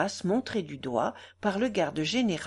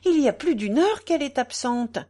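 higher, louder cry of concern; raised, urgent storybook outcry; exclaiming; 6 semitones higher, 7 dB louder than usual. A narrator's reading. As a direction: exclaim it as a worried mother figure would. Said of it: "s'écria madame Michaud".

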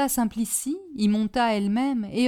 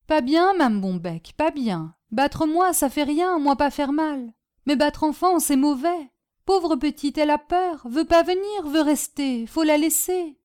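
lightly voiced servant in broken French, pitched higher; Polish servant pleading; 6 semitones higher, 4 dB louder than usual. Servant Boginski blocking the aunt, pleading higher. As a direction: plead simply, gently standing firm.

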